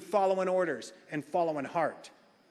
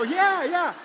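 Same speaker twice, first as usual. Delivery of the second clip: laughing yes; 12 semitones higher, 7 dB louder than usual. Retold or imitated. Excited yes to wife, laughing.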